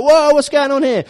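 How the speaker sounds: a suspicious local stopping strangers; startled locals. Acted. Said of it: "They're like".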